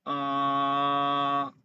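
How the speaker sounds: "Uh" sung as a held Mandarin tone; second tone demo. Shown as a demonstration. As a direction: glide "Uh" as a Mandarin tone. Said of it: "the second tone is from low to high".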